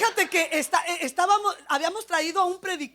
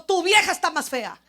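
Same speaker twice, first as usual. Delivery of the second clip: loud mock shout back; yelled comeback; 4 semitones higher, 8 dB louder than usual. Angry woman answering the rude neighbour, mock shouting. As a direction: fire the insult back, raised voice. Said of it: "le grito".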